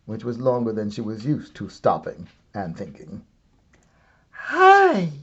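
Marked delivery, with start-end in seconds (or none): 4.3-5.1 put-on much higher, chirpy little girl voice; a sudden high squeak of realisation; girl to herself. A narrator's reading. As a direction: Goldilocks talking to herself in alarm. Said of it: "she told herself".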